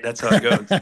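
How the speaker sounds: usual speaking voice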